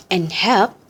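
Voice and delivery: plain reading voice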